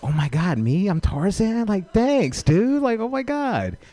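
very high, thrilled squeal; flattered gushing. Acted. Comic thrilled at a stranger's compliment, high gushing voice. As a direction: gush in delighted disbelief, voice climbing. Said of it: "I was like".

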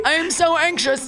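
high, jittery anxious voice; mock anxious self. Mocking her own sober self, high and anxious. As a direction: squeak it out like a nervous wreck. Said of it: "I'm like".

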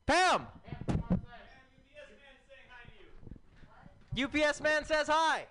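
high, carrying shout across a room; calling out. Real, not acted. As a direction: holler it to someone far away.

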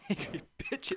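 high breathy laugh through words. Real, not acted.

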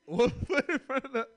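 high laughing through the words; mimed trudge with a high laugh. Retold or imitated. Acting slow walk home.

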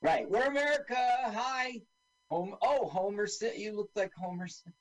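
high, hammed-up American greeting; mock American accent. Impostors pretending to be the American army, mock American accent. Voicing fake Americans from the film.

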